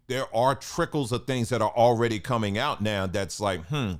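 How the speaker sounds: ordinary delivery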